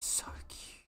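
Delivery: breathless whisper of adoration; hushed whisper; whispered awe. Real, not acted. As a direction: whisper it, overcome by cuteness. Overwhelmed by puppy.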